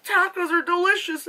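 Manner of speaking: high, laughing squeal; laughing quote. Retold or imitated. Vlogger recalling her taco reaction, squealing with laughter. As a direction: giggle through the words. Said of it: "and I was like".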